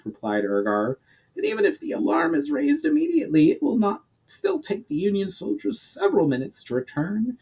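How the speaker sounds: high, pinched goblin adviser; high-voiced goblin laying out the problem. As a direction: pitch it up like a scheming goblin. Urgar replies to Myra.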